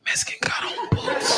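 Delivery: hushed, voiceless warning; secretive whisper. Acted. New inmate tells somebody else, whispered. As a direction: pass it along under your breath.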